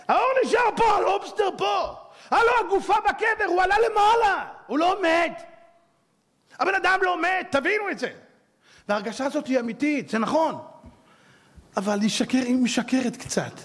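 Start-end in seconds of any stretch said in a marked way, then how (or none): 0.1-8.1 preaching shout. Acted. Rabbi drives home to the congregation, shouting high and fervent. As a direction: proclaim it at full force. Preaching climax, man never dies.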